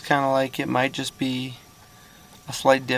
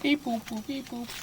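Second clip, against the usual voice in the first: high beeping robot noises; toy robot voice; 11 semitones higher, 8 dB quieter than usual. Pretend. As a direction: beep like a little toy robot. Playful robot noises over camera feed.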